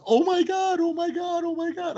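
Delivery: high mock shock, fading toward the end; a hammed-up oh my God; shocked gasp. Customer stunned the owner called, high mock panic. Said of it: "She goes".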